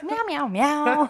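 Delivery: slow, high meowing; kitten voice. Acted. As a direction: meow like a kitten. Meowing like a cat. (Kätzchen-Miauen)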